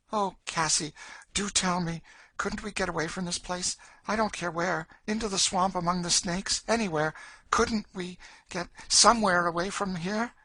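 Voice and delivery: put-on high, desperate girl's plea; pleading Emmeline. Girl begs companion to escape with her, high and pleading. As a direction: beg her for a way out.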